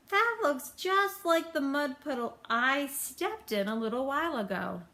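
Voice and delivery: put-on a childlike, wondering bird; big bird voice.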